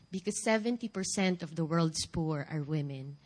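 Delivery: ordinary talk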